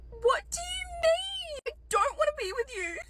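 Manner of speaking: high, drawn-out mock wail; outraged disbelief. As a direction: wail it slowly, hammed-up and indignant. Her outraged inner reaction.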